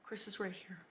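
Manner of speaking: very soft, whispered comfort; mock-tender, whispered reassurance; soothing whisper. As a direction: hush someone gently so they don't cry. Comforts a fan who would cry.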